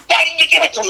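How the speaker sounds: high, urgent disbelief at a frozen friend; Pete's exasperation. Retold or imitated. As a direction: push it up high like a bandmate who can't believe it. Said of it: "And Pete goes".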